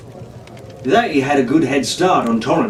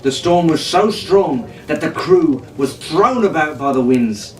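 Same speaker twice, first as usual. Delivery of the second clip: ordinary delivery, nothing marked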